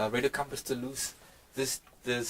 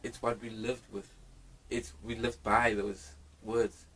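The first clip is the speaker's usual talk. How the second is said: ordinary delivery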